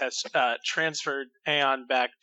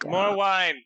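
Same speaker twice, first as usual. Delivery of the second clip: higher, raised call for a drink; drunken call-out; 4 semitones higher, 4 dB louder than usual. Acted. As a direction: holler it like a tipsy patron. Drunk character calls for wine.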